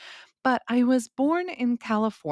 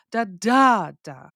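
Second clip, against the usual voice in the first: quick "da", big long "da", lighter "da"; stress on middle beat; 6 dB louder, slower than usual. Shown as a demonstration. Teacher beats out the can't pattern, middle "da" stressed. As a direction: hit the middle "da" hard. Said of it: "the emphasis is on the can't".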